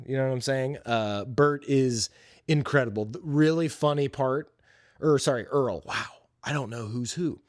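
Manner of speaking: normal talking voice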